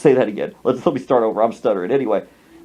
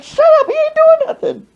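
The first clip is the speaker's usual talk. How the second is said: very high, loud comic yell; hammed-up exasperated outburst; exasperated mock shriek; 5 dB louder than usual. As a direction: screech it at a cheering crowd. Yells at the cheering family.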